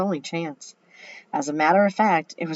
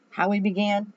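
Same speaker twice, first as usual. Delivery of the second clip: ordinary delivery, nothing marked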